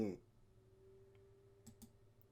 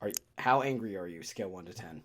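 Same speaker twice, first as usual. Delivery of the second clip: asmr whisper; 9 semitones lower, 9 dB quieter, faster than usual. Acted. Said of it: "get very close to my mic here".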